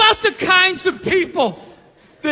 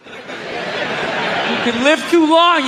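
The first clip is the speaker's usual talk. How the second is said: slow drawn repeat; much slower than usual. Real, not acted. Comic repeats his line slowly for the punch, drawn out.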